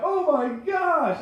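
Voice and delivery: put-on high, slow wail of a heartbroken little boy; crying kid voice. Little cousin crying to his grown cousin, high slow wail. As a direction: whimper it like a crushed kindergartner.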